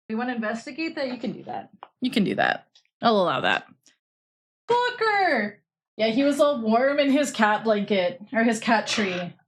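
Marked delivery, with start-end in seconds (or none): 4.7-5.6 high sudden call of the name. Real, not acted. Owner calling out to the cat Booker, high and sudden. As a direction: yelp the cat's name.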